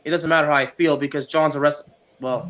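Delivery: ordinary talk, nothing marked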